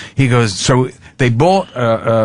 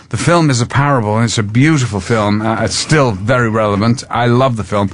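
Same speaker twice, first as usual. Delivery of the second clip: ordinary delivery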